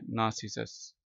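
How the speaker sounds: नार्सिसस said nah-si-sus with the r silent, British accent; नार्सिसस as nasisəs, no r sound at all; Narcissus British style. Shown as a demonstration. Teacher showing students नार्सिसस, said nasisəs with r silent. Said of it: "नार्सिसस पढ़ना है इसको, आर silent है".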